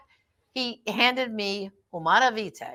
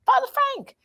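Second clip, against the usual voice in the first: put-on little girl; 5 dB louder than usual. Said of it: "And she goes".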